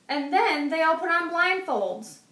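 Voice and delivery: plain reading voice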